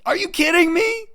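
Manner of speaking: very high, loud squeak of disbelief; very high, loud incredulous squeal. Real, not acted. Repeated disbelief.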